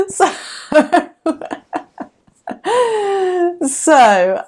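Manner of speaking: high laughter through the words; laughing. Real, not acted. Still laughing at her friend's gif while telling viewers, real laughter. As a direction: laugh as you try to go on.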